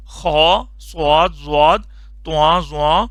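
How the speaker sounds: خا, صاد, ضاد, طوا, ظا said full-mouthed and heavy, each one clipped off; heavy letters. Shown as a demonstration. Teacher shows pupils the always-heavy letters, bold. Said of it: "وہ ہمیشہ bold ہوتے ہیں".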